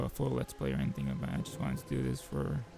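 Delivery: usual speaking voice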